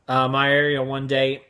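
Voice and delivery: ordinary talk, nothing marked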